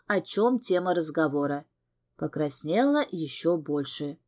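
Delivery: plain reading voice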